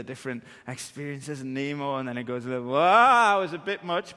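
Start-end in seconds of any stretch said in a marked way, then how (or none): 2.7-4.2 higher, louder startled whoa. Retold or imitated. Dad jolted on the coaster, startled exclamation. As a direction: caught off guard by a sudden drop.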